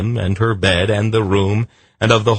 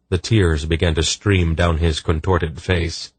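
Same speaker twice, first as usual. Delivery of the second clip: usual speaking voice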